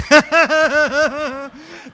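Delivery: high, loud full laugh; demo laugh. Shown as a demonstration. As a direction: let a huge laugh roll out. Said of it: "just laugh".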